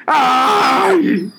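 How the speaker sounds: high, loud pained yelp. A narrator's reading. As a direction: cry out as your tail burns. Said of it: "gritó el lobo".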